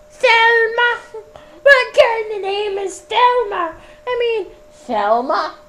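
put-on high, far-off old woman; Scottish woman voice. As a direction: squeak her name out high and proud. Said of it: "various different accents".

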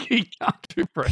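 laughing through the words. Real, not acted. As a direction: giggle while saying it.

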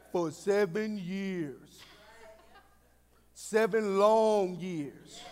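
very slow, low and drawn out; low, lingering emphasis; weary long wait. Acted. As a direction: stretch it to feel the length of the wait. Dwelling on Jacob's long wait.

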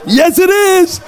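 high, squealed mock cheer; happy program member. Program member insisting to doubting newcomer, overjoyed. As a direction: parody an over-happy believer. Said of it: "One of those Teen Challenge happy people".

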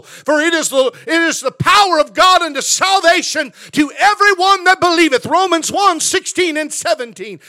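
preacher building to a shout; full-force preaching. Acted. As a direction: drive the verse home to the congregation.

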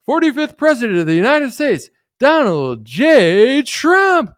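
mock announcer declaring the new president. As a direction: boom it out like a TV result call. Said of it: "You ever seen it".

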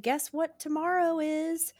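lightly voiced teacher, high and slow; a teacher building suspense for kids; teacher teasing. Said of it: "they said".

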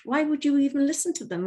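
high-pitched imitation of a baffled friend; incredulous. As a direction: retell her disbelief, pitched well up. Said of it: "she was just incredulous".

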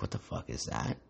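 put-on a drunk man's spooked whisper; a whispered what the hell; hushed drunk whisper. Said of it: "he's like".

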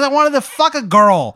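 high and loud confession; Jay admitting why he stopped at the fire; sheepish Jay. Acted. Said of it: "He goes".

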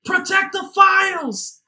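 high, drawn-out officer's yell; over-the-top urgent command, loud and stretched; mock panicked shout. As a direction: shout orders like a hammy movie officer. Acting officers in crisis in film.

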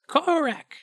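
high-pitched kobold. Acted. Kobold pointing at himself, high kobold voice. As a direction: say your name high as a kobold. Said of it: "points at himself and goes".

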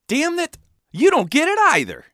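customer cries out, pitch shooting high; frustrated customer whines high and loud; exasperated high outburst. A narrator's reading. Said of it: "he sighed".

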